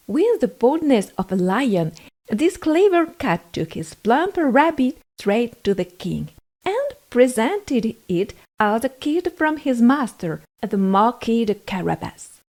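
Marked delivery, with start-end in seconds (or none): none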